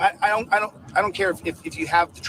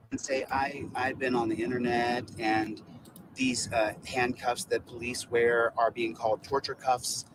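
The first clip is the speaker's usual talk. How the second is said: low, soft town hall speaker; meek, humble; 6 semitones lower, 6 dB quieter than usual. Pretend. Activist showing supporters how to address a town hall, meekly. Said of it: "with your meek, humble voice".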